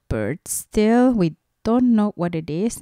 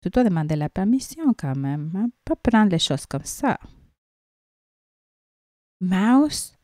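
ordinary delivery, nothing marked

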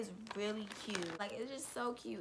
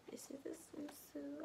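whisper; 6 semitones higher, 9 dB quieter than usual. Real, not acted. Whispered aside over swimsuit.

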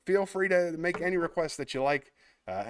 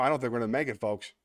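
usual speaking voice

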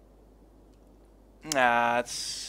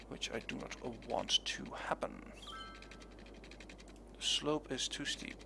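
whispering; 9 dB quieter than usual. Real, not acted. Worried aside.

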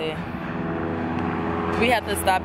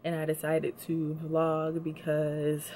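usual speaking voice

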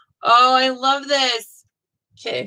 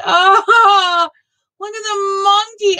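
much higher and louder, thrilled; delighted squeal; 11 semitones higher, 5 dB louder, slower than usual. Real, not acted. Crafter spots the monkey on a cross-stitch, squealing with delight. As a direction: squeal at the sight of it.

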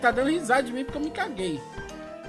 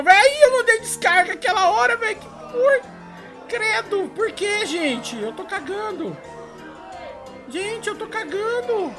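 panicked yelling; 10 semitones higher, 5 dB louder than usual. Real, not acted. Panicked desperate gameplay.

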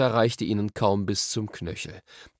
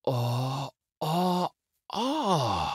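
series of drawn-out ohs, rising higher; dawning "oh"; 5 semitones higher, much slower than usual. A narrator's reading. Diggory struck by an idea at the pond, marvelling. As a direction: let each oh climb as an idea dawns. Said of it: "machte Diggory".

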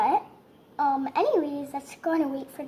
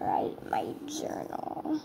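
whisper; 8 dB quieter, slower than usual. Real, not acted. Quietly narrating her own action.

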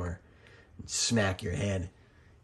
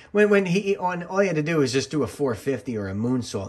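higher, louder, explaining with mock urgency; a bit worked up; 6 semitones higher, 5 dB louder than usual. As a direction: lay out the obvious fix, a little worked up. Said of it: "And he's like".

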